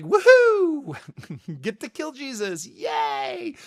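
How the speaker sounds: high, loud mocking woohoo, gleefully sarcastic; mock giddy cheer. Preacher mocking a cheerful God at the cross, high mock glee. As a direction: parody a gleeful God cheering, then deflate. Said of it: "it's not that".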